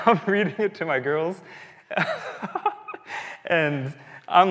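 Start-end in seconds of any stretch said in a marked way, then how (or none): 1.9-3.4 squeaky high laugh through tears; giggling at himself for weeping; high laughing squeal. Real, not acted. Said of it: "like, weeping".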